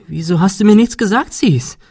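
high and loud, voice breaking upward; voice cracking with agitation. Acted. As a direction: blurt it out, overwhelmed and upset. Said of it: "Ihre Stimme überschlug sich vor Aufregung".

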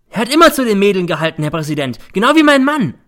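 fast, high, loud outburst; heated accusation. A narrator's reading. Mother accusing son to the judge, heated. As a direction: blurt it in outrage.

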